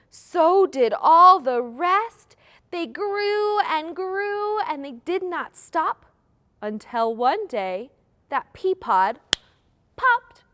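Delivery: slow, emphatic rhyme recital, building to a high, loud last word; nursery rhyme. Shown as a demonstration. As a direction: draw out each beat for children to follow. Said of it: "try it with me this time".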